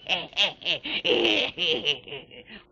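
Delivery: put-on a slow wicked old cackle; creepy cackle. Old lighthouse keeper opening his tale, wicked cackle.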